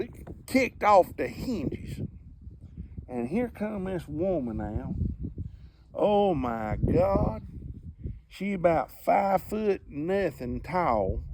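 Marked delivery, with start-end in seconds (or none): none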